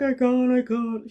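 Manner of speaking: anxious protest. Retold or imitated. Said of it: "I'm like".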